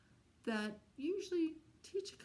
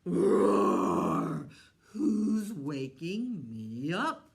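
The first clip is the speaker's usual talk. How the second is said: big booming roar, then a slow, growled demand; angry lion roar; 3 semitones lower, 13 dB louder, much slower than usual. Acted. Lion woken by a mouse roars at it, booming loud. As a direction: roar like a lion rudely woken from his nap. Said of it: "And the lion went".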